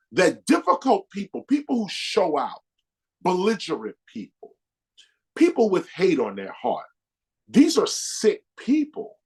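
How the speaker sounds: usual speaking voice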